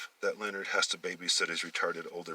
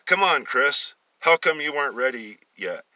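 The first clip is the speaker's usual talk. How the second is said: higher, louder scolding; 6 semitones higher, 8 dB louder than usual. Acted. Older brother scolding his brother for not being ready. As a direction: hurry him up sharply. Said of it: "Leonard scolded".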